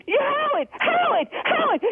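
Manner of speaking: high, insistent calls for attention; frustrated caller crying out a name; real exasperated calling out. Real, not acted. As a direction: demand to be heard, pitch jumping up. Upset caller demands to be heard.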